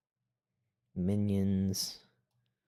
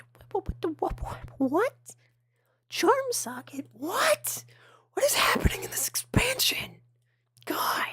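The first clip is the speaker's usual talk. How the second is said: high, frantic shouted whisper; whisper yelling; 7 dB louder than usual. Real, not acted. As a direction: yell in a whisper, stunned. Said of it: "I know whisper yelling isn't very effective".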